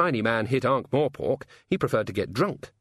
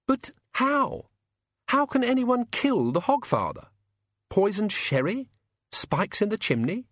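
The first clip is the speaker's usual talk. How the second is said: Susan voice; 12 semitones higher than usual. A narrator's reading. Susan alarmed.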